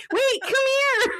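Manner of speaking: high, slow calling out; calling the cat. Retold or imitated. Chasing the cat and calling it back high. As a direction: coax the fleeing cat in a squeaky voice.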